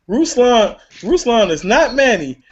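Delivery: mouse voice. Pretend. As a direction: lift it up into a little mouse voice. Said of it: "I gotta do this in my mouse voice".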